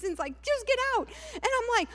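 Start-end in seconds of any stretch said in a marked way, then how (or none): 0.4-1.1 imitated yell, pitched way up; mock raised voice. Husband to wife in the garage fight, mock raised voice. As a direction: mimic your husband's outburst, high. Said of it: "raised his voice".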